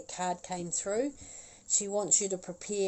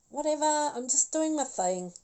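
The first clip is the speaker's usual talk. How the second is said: higher, breezy whatever-voice; dismissive attitude; 8 semitones higher than usual. Retold or imitated. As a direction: toss it off like you couldn't care less. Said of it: "and the attitude".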